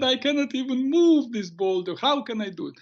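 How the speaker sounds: put-on high, tearful teenage whine; a boy close to tears pleading; crying teen. Tearful boy pleading with the shaman, high whimpering voice. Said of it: "the boy started crying".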